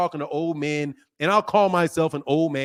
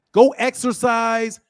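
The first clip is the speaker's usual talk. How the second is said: shouting; 6 semitones higher, 6 dB louder, slower than usual. Real, not acted. Yelling advice at caller.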